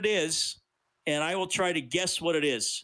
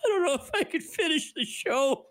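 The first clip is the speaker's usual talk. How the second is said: helpless laughter. Real, not acted. Animal sound makes host crack up.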